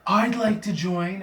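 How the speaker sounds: a shy pony pretending to join the cult; forced Fluttershy. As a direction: blurt it out, hammed-up. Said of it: "she's like".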